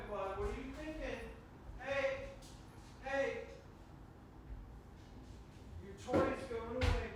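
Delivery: dog owner scolding the puppy in a high voice. Real, not acted. Scolding dog after accident.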